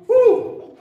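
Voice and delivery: joyful whoop. Retold or imitated. Disciples exulting over power.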